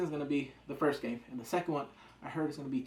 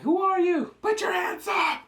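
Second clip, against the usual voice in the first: threatening shout; 8 dB louder, slower than usual. Retold or imitated. Masked riders confront them.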